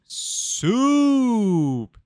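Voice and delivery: long, high, loud held call; show-opening call. Acted. Podcast host kicking off the show, long loud call. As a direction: belt it out and stretch the vowel.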